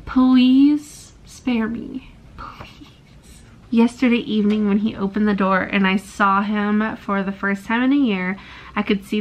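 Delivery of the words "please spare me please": slow breathy begging whisper; whispered plea. Real, not acted. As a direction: beg in a slow desperate whisper. Begging the author.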